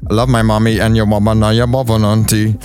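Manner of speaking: Jamaican patois ragamuffin chant; playful dancehall toasting. Shown as a demonstration. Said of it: "This is how I got my ragamuffin, uh, voice".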